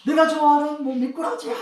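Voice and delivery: mock shout of a kid thrilled by the catch; hammed-up cheer at a net full of fish; excited yell. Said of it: "이렇게 막 소리 지르면서".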